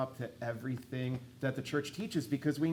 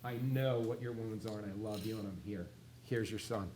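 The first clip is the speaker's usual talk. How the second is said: tender, soft; 5 semitones lower, 12 dB quieter than usual. Retold or imitated. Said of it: "he said".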